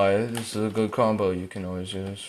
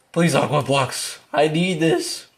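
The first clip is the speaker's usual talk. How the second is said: higher-pitched begging; pleading; 7 semitones higher than usual. Real, not acted. Player begging the game for help, pleading. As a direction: beg for help mid-chase, voice raised in pitch. Begs the game during chase.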